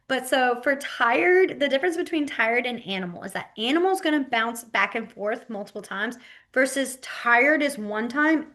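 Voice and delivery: normal talking voice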